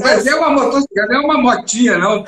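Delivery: high, loud words bursting through laughter; laughing hard. Real, not acted. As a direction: crack up while protesting. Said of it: "tô até chorando de rir". (chorando de rir)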